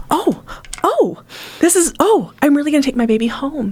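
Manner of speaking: high, rushing joyful disbelief; overjoyed mom. Retold or imitated. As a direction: gush it out in happy disbelief. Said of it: "they're just".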